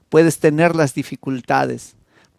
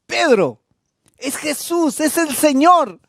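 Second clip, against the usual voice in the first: high, breathless excited cry; excited recognition; 13 semitones higher, 3 dB louder, slower than usual. Acted. John tells Peter it is the Lord, excited high cry. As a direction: burst out with the discovery.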